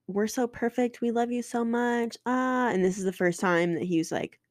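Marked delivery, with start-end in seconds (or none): 0.1-2.7 higher, mock-sweet put-on cheer; mocking fake facade. Mocking her mom's fake perfect-family act, in a higher mock-sweet voice. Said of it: "the fake facade".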